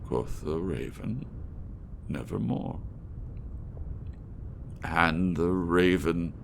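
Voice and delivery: theatrical recital with swelling passion and hushed ends; dramatic poem reading. Acted. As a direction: recite it like a stage performance. Reads The Raven aloud.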